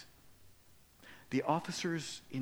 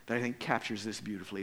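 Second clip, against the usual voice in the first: ordinary talk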